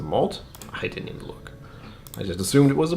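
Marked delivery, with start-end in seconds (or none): 0.7-1.5 whispered aside to herself. Real, not acted. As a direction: breathe it under your breath.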